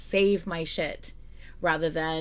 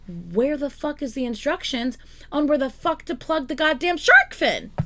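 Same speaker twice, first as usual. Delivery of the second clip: exasperated shout; 5 semitones higher than usual. Real, not acted. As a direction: shout the complaint. Rant about missing instructions.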